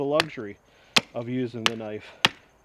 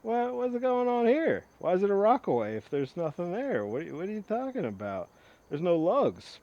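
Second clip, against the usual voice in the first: mock baffled viewer asking what's going on; sceptical viewer; 7 semitones higher than usual. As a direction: play the confused viewer, pitched up. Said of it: "and you're, you're thinking".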